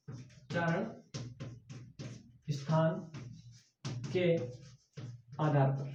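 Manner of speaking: "उच्चारण", "स्थान", "के", "आधार पर" each said separately, quiet and slow; slow dictation. Shown as a demonstration. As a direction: read it out for students to write down.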